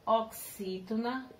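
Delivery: ordinary talk, nothing marked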